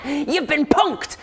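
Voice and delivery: high, loud, slow gloating cry; gleeful prankster. Acted. Satyr gloating at the tricked crew, high and loud. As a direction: crow the prank reveal. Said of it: "and he goes".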